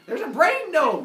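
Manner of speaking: high, very loud cry of alarm; panicked shout. Retold or imitated. Hephaestus warning Zeus, high panicked shout. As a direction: yell the alarm in comic panic.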